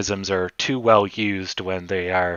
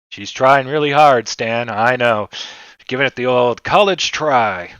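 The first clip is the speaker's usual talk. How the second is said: hammed-up sports announcer, louder; play-by-play banter with a co-host; frantic mock sports commentary; 4 dB louder than usual. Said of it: "sports commentator voice".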